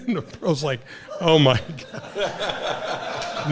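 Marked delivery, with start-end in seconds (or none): 1.2-3.5 slow drawn-out aghast oh my God with a laugh; stretched laughing disbelief; mock disbelief. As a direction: sound stunned at the cheek. Pro's shocked reaction.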